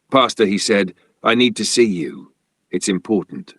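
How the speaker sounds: ordinary delivery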